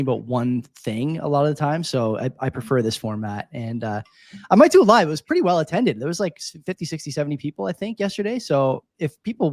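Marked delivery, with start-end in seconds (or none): 4.5-5.1 excited. Real, not acted. Excited announcement.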